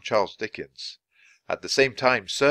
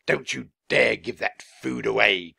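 slow, indignant innkeeper's warning; mildly outraged character voice; indignant cry. A narrator's reading. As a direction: forbid it slowly, word by word. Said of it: "cried Mister Pinch".

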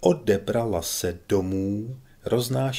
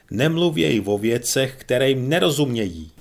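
scolding commander; 3 semitones higher, 6 dB louder than usual. A narrator's reading.

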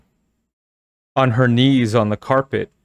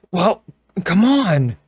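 high, louder push; impatient urging; 8 semitones higher, 3 dB louder, slower than usual. Acted. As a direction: hurry her along. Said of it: "she urged impatiently".

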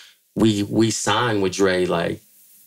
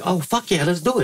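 high, keen agreement; mock eager yes; 9 semitones higher than usual. Crew accepting the DVD offer, high eager voice. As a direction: jump at the offer. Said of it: "So we were like".

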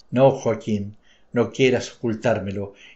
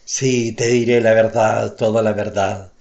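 almost shouting; 5 dB louder than usual. Acted. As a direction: blurt it out, forcing the truth. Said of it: "Gritó casi".

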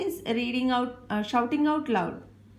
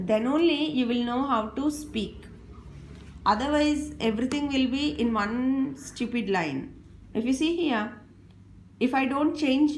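normal talking voice

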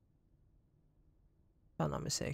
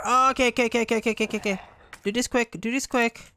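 frantic, loud and high; panicked; 6 semitones higher, 4 dB louder, much faster than usual. Real, not acted. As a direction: rush the words in panic. Fleeing zombie in horror game.

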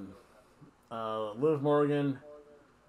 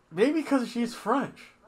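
sarcastic aside; 10 semitones higher, 3 dB louder, slower than usual. Pretend. Sarcastic reason.

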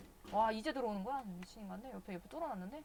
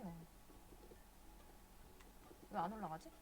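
ordinary talk

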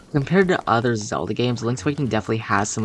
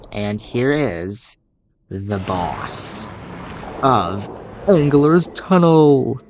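dramatic announcement; much slower than usual. Acted. Dramatic boss introduction.

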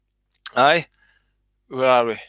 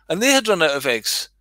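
ordinary talk

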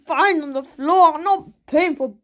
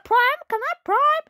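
put-on whiny begging kid; 11 semitones higher, slower than usual. Child begging for Prime.

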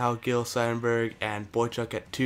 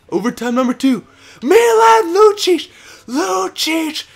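very high, loud screamed celebration; ecstatic shouting; 12 semitones higher, 11 dB louder than usual. Real, not acted. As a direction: scream the overtime winner. Overtime winning goal celebration.

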